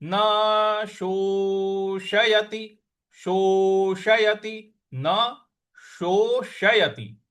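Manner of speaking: शोषयति drawn out very slowly, retroflex sh curled back; slow pronunciation demo. Shown as a demonstration. Teacher shows students the retroflex sh of शोषयति, very slow. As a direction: curl the tongue for the sh of शोषयति, very slowly. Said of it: "जीभ को रोल करके मूर्ध्वा पे लगा करके".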